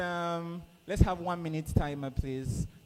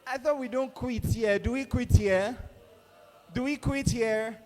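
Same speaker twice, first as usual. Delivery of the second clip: playful challenge; 8 semitones higher, 3 dB louder than usual. Real, not acted. Challenging a hesitant contestant.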